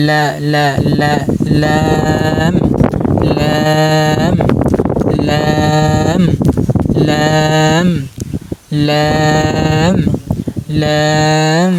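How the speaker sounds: লা short, then লাম held long on a level note; tongue tip to the gum for লাম, drawn out evenly; tongue-tip L drill. Shown as a demonstration. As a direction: chant লা, then stretched লাম, as an articulation demo. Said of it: "মাড়ির সাথে লাগিয়ে উচ্চারণ করবেন".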